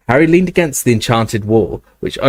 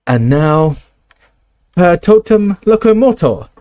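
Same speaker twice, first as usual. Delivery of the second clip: high, louder commanding incantation; cried spell; 7 semitones higher, 3 dB louder than usual. Acted. McGonagall summons the castle statues, loud and high. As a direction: cry out the spell with authority. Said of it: "cried Professor McGonagall".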